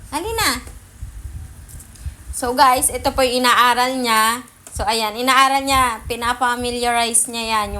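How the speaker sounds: usual speaking voice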